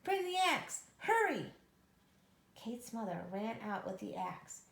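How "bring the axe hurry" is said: loud, high shout. Acted. Kate calling her mother mid-chase, loud shout. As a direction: yell for the axe in a panic. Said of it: "she shouted".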